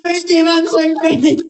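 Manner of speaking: emotional loud outburst. Real, not acted. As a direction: blurt it out, overcome. Emotional celebrant after prank reveal.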